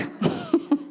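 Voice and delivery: short high chuckle; light amused laughter. Real, not acted. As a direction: laugh at your own mock scolding. Laughs after own mock scolding.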